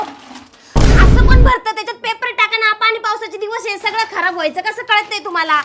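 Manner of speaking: scolding yell. Acted. Wife scolds husband to put paper in it, yelling. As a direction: open with a loud burst, keep nagging. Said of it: "ओरडू नको".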